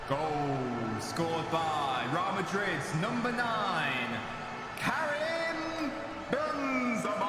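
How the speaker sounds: drawn-out stadium goal announcement; announcer effect. Pretend. Streamer announcing Benzema's goal to viewers, long-held announcer shout. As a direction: stretch the scorer's name out high and long like a stadium announcer. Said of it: "that announcer effect".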